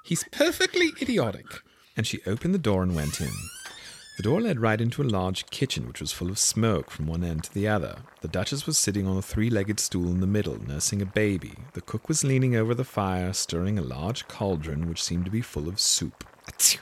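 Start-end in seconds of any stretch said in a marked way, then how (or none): 0.0-1.5 put-on exasperated girl. Alice exasperated aside. 16.5-16.8 sneeze. Acted. Sneeze in pepper kitchen.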